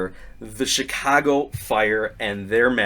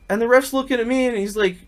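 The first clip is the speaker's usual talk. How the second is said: ordinary talk, nothing marked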